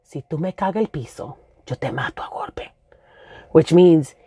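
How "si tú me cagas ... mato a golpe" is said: mom's threat. Retold or imitated. Mother grabbing her daughter's shirt, hushed threat. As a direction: whisper it like a dangerous mother. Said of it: "she was just like".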